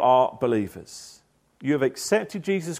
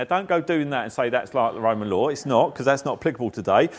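usual speaking voice

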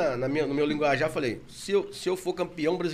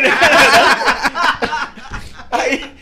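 usual speaking voice

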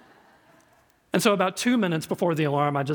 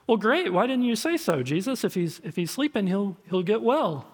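higher, brightly relieved exclamation; pitched up, cheerfully missing the point; naive relief; 4 semitones higher than usual. Acted. Disciples misreading Jesus about sleeping Lazarus, relieved.

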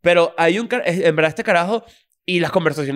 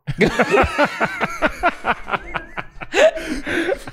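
high, wheezing laugh at his own story; real burst of laughter; 10 semitones higher than usual. Real, not acted. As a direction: crack up laughing.

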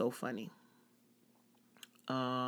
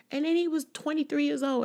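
high, loud disbelief; high, loud mock-horrified reveal; shocked; 14 semitones higher, 7 dB louder than usual. Real, not acted. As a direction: blurt the age out in shock. Shocked at waiter's age.

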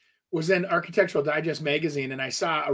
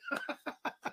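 laugh; 13 dB quieter than usual. Real, not acted. Laughs at own remark.

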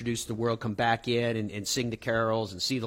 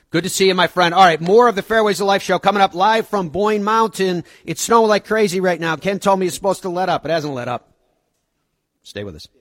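radio announcer; 6 semitones higher, 9 dB louder than usual. Acted. Radio show host read.

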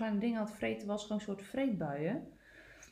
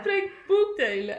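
teasing; 11 semitones higher, 10 dB louder, slower than usual. Real, not acted. Teasing the laughing friend.

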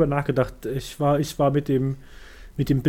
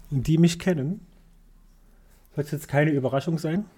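normal talking voice

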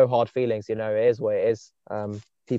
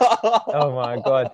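slow "Oh my God" through a laugh; real laughter at the teasing; 4 dB louder, much slower than usual. Real, not acted. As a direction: laugh it out, stretched. Laughing at friend's praise.

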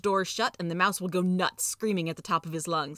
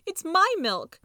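high, loud mouse insistence; tearful high-pitched protest; 11 semitones higher, 6 dB louder, much slower than usual. A narrator's reading. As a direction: insist possessively in a squeaky, upset voice. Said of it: "his tears splashing onto the pavement".

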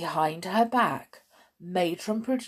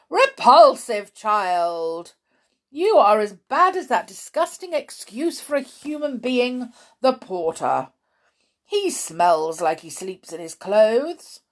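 loud, theatrical contempt with mocking ha-ha; disgusted, scornful matron; 7 semitones higher, 7 dB louder than usual. A narrator's reading. Matron scolds Tom in horror, disgusted. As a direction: heap scorn on the child, loudly.

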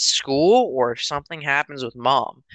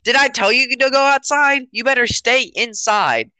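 high, louder mock scolding; hammed-up angry parent, pitched up; yelling parent; 10 semitones higher, 4 dB louder than usual. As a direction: imitate a parent barking orders. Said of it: "Why are you yelling at me".

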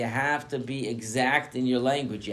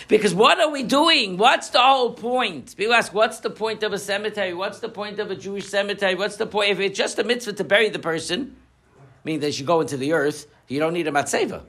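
higher, louder sermon voice building to a point; fervent preaching; 10 semitones higher, 7 dB louder than usual. Acted. Rabbi driving home the meaning of stones, fervent preaching. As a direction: preach it to the congregation with full conviction.

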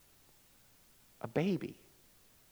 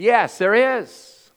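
homeless man's answer; 8 semitones higher, 11 dB louder, much slower than usual. Retold or imitated. Homeless man answers Tony.

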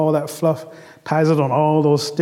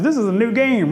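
higher, eager exclamation; excited rabbit; 6 semitones higher than usual. Acted. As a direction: announce a brand-new game eagerly. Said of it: "Rabbit's excited".

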